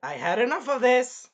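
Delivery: high, loud fed-up shout; imitated angry outburst; very pissed. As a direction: explode as if patience has run out. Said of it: "I would be very pissed".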